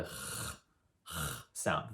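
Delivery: j scraped from the back of the throat; guttural j. Shown as a demonstration. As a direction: rasp the j low. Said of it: "very guttural".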